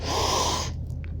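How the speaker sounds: mock horrified gasp; dramatic gasp. Mom character reacting to bad news, theatrical gasp.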